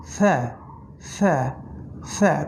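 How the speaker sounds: সে said very lightly, tongue at the teeth; a light সে, not সা; light letter sound. Shown as a demonstration. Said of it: "আমি বলেছি".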